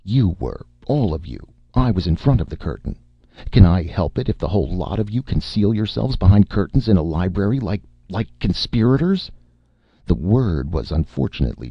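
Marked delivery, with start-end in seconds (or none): 0.0-9.3 blustering, climbing higher to the end; bravado. A narrator's reading. Martin accusing the whole room, with rising bravado. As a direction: turn the accusation round with bluster. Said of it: "with great bravado".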